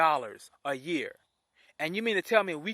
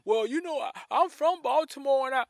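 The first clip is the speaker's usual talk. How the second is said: higher, put-on plaintive voice; mocking reporter imitation; 7 semitones higher than usual. Host mimics the CNN reporter from Baltimore, mocking. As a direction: mimic the reporter's excuse in a raised voice. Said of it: "Then on top of that".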